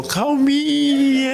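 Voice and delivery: high, drawn-out punchline; comic mock-calm. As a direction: stretch the last word up high for laughs. Comic punchline on calmed wife.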